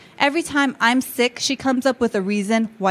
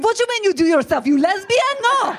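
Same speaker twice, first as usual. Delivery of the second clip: pitched higher, indignant mock husband breaking into a laugh; mock jealous husband; 8 semitones higher than usual. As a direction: play the outraged husband, half laughing. Jealous husband voiced again.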